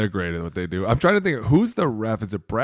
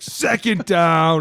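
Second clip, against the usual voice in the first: flexing ref call; 9 semitones higher, 8 dB louder, much slower than usual. Retold or imitated. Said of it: "he was like".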